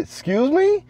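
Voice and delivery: high, soft disbelieving excuse me?; quiet, rising disbelief; incredulous excuse me. Retold or imitated. Retold reply to rude aunt.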